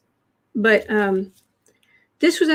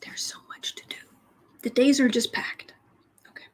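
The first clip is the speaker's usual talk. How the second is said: unvoiced whisper to herself; 4 dB quieter than usual. Real, not acted. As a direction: breathe the words to herself, no voice. Muttering to herself, fan on.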